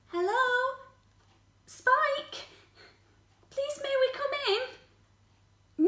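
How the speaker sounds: high, tentative coaxing; gentle request. Acted. Visitors at upset Spike's door, high gentle coaxing. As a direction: ask sweetly to be let in.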